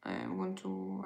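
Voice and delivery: ordinary talk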